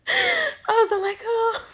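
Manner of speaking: high, slow, crying voice. Real, not acted. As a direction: let the tears break through, slowly. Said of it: "I'm so emotional".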